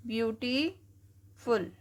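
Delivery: Beautiful stretched out slowly, each syllable apart. Shown as a demonstration. Teacher breaking a word into syllables for students, slow. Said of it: "तोड़ के पढ़ते हैं हम".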